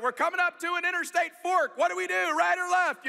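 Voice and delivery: imitating a dad hollering for directions; dad calling out. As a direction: call back from the driver's seat, high and urgent. Dad driving, calls back for directions.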